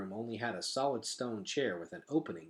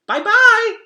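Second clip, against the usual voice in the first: put-on very high, loud little-girl goodbye; toddler voice; 21 dB louder than usual. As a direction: chirp it like a toddler. Toddler Boots waving.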